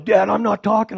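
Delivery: high, loud protest to Dad; panicked refusal. Retold or imitated. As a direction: imitate a son loudly refusing in alarm. Son's panicked refusal, retold.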